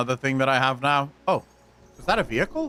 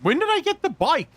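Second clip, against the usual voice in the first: high startled question; surprised; 13 semitones higher than usual. Real, not acted. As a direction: blurt it out, caught off guard. Surprised at new bike.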